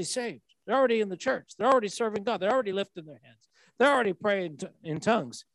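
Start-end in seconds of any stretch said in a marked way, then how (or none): none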